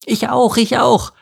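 high, eager, repeated squeal; high excited little character voice. A narrator's reading. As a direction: bounce with enthusiasm. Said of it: "begeistert hüpfte Steffi".

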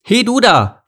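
high, loud sharp call; snapping at a worker. A narrator's reading. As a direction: snap at him. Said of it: "fuhr sie ... an".

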